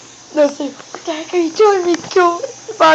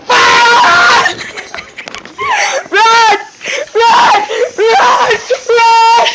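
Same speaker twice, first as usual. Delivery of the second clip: panicked shout; 6 semitones higher, much slower than usual. Acted. Kid warning friends to flee the brother, high shouts. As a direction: scream at everyone to run.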